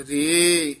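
ordinary delivery

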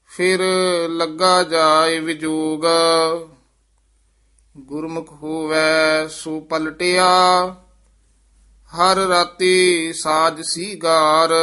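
measured, sustained verse recital; scripture recitation. Acted. Teacher reciting scripture for students, measured recitation. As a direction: recite sacred verse with drawn-out line endings.

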